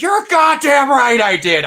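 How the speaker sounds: high, loud courtroom outburst; hammed-up furious scream; screaming film impression. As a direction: bellow it like a cornered witness. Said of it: "he screams at you".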